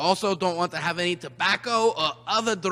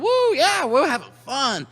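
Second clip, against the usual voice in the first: excited cheer; 6 semitones higher, 6 dB louder than usual. Pretend. Cheering for toys.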